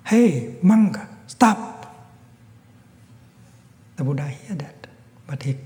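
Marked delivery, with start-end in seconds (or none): 0.0-1.9 loud, high shout to stop him; angry shout, high and loud; calling out. Acted. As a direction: bark the order at the monk. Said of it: "He called out to the Buddha".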